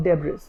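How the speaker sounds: debris with the s sounded; wrong pronunciation. Shown as a demonstration. Teacher repeating the likely mistake slowly, debris with final s. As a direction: say debris as "deb-riss". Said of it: "You might pronounce this word as".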